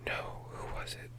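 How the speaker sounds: whispered aside to herself. Real, not acted. Whispering to herself while searching for a friend's name. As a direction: breathe the question out with no voice.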